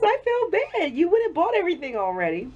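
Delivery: high, giggly quote of his apology; quoting through laughter. Retold or imitated. Quoting her boyfriend's apology, giggling high throughout. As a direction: quote him while you can't stop laughing. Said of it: "I keep laughing".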